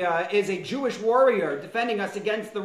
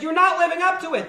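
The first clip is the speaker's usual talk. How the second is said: mock indignant accusation; 7 semitones higher, 5 dB louder, faster than usual. Job accusing God.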